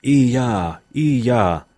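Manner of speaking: understated cry in a storyteller's voice; mild high-voiced cry. A narrator's reading. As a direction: read a restrained mock holler. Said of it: "huusi korkealla äänellä".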